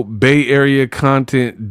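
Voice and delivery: normal talking voice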